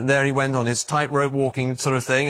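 usual speaking voice

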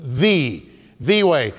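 "the" isolated and stressed as "thee"; emphatic THE. Shown as a demonstration. Preacher shows the congregation the emphatic "the", said as "thee". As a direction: demonstrate a strong "the", said "thee". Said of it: "he uses the emphatic".

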